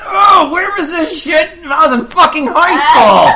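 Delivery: mock anguished outburst. Real, not acted. Wails about missing internet porn.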